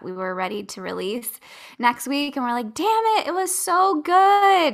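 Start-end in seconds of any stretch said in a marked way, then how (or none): 2.7-4.8 high, drawn-out exasperated groan; frustrated outburst. Retold or imitated. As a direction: vent the frustration, dragging it out. Frustrated over the lost recording.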